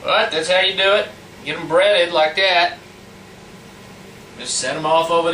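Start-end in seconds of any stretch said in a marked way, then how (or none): none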